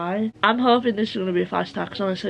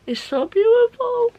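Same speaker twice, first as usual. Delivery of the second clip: gushing; 9 dB louder than usual. Real, not acted. Gushing over a book scene.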